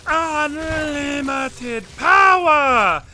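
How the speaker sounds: high, slow, booming shout; villain shout. Acted. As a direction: roar it like a mad emperor. Quoting a famous villain line.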